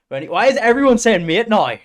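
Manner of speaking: a real exasperated yell at chat; exasperated shout. Real, not acted. Streamer yelling at chat over "mate", exasperated shout.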